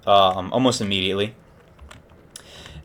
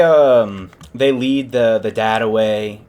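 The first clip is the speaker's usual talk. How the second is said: normal talking voice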